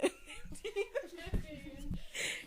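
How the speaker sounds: soft, high, breathy laughter; giggle fit. Real, not acted. Reader laughing after misreading eighteen. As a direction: laugh at own slip.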